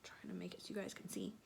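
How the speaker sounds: a fast under-the-breath aside; whisper. Real, not acted. Aside while showing the pictures.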